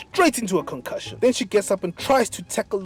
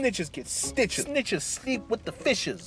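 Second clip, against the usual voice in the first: put-on tough kid; 6 semitones higher, 5 dB quieter, slower than usual. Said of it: "the kids are like".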